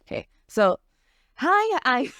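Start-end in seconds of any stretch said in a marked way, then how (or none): 1.3-2.1 put-on higher, light girlish greeting; high-pitched girl voice. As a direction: go up high for a sweet character. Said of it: "introduce myself as Celestine".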